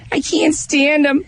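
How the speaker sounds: put-on high, shouted old lady voice. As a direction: squawk it like the outraged coworker. Coworker Jenny voiced, racist rant.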